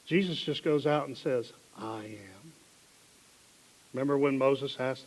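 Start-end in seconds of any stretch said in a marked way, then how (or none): 1.8-2.5 quiet. Acted. Jesus quietly answers soldiers.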